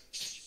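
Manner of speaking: short sharp hush; shush. Acted. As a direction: imitate shushing a parent. Imagined shushing his dad.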